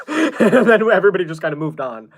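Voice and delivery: high, loud burst of laughter into speech; real laughing. Real, not acted. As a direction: talk through a big laugh. Laughs at the absurd anecdote.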